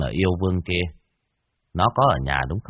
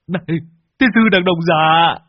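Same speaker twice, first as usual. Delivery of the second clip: high, loud drawn-out curse; cursing yell; 10 semitones higher, 8 dB louder, slower than usual. Acted. Lâm Sẹo shoved forward as a shield, cursing loudly. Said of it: "thì chửi".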